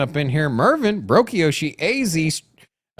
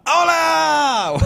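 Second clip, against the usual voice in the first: shouted greeting; 13 semitones higher than usual. Real, not acted. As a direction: belt out the greeting.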